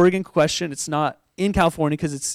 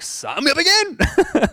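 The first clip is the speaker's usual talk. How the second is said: high, drawn-out comic enthusiasm; mock-eager squeal; 10 semitones higher, slower than usual. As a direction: pipe up in a high, keen voice. Acts out eager retake.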